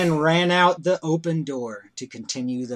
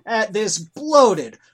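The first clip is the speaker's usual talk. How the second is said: scornful ranting; 7 semitones higher, 4 dB louder than usual. A narrator's reading. Narrator mocking Trump-like target.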